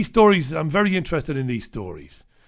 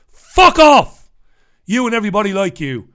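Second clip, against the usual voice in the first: furious shout, much higher and louder; angry shout; 4 semitones higher, 9 dB louder than usual. Real, not acted. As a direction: explode at them in real outrage. Outburst at the critics.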